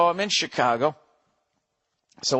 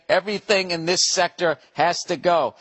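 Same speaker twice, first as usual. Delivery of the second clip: normal talking voice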